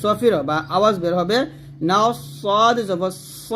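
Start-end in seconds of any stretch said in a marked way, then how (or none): 1.9-3.5 slow spelling, "স" thick and rounded; heavy Sad. Shown as a demonstration. Teacher showing the thick letter, heavy "স". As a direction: hiss "নাফস", then a full emphatic "স". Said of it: "এটা মোটা হবে".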